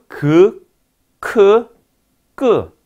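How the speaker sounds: "그, 크, 끄" as separate plain, aspirated and tense stops; Korean velar stops. Shown as a demonstration. Lecturer lists velar stops for students, "그, 크, 끄" loud and separate. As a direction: punch out each velar: "그", "크", "끄". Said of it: "우리나라 말에는 폐쇄음".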